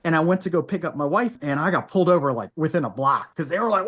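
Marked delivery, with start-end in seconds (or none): none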